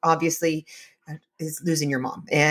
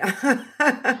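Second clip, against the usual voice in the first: teary laugh; 8 semitones higher than usual. Real, not acted. Moved host laughs through near tears. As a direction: laugh instead of crying. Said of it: "I need to have a cry".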